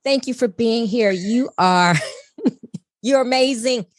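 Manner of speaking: normal talking voice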